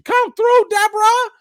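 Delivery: high, loud shout-out; hyped holler. Real, not acted. Streamer welcoming a viewer, hyped holler. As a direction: call it out big and high-pitched.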